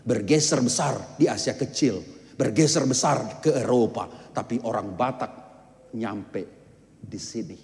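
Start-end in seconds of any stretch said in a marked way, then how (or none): 4.3-7.6 softer, lower, drop the voice to land it; hushed landing. Real, not acted. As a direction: let the point fall quietly. Preacher lands point softly. (suara direndahkan perlahan)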